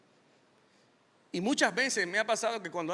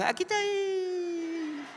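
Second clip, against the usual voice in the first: much higher, silly little voice; silly Adam; 13 semitones higher, slower than usual. Pretend. Preacher voicing Adam answering from hiding, high and silly. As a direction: squeak it like a hiding child. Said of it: "Y Adán".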